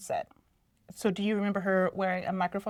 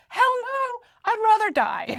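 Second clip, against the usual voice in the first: much higher, louder outraged whine; high mock Luke; 13 semitones higher, 5 dB louder than usual. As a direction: squeal out a defiant refusal. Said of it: "And he's like".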